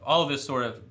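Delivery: ordinary delivery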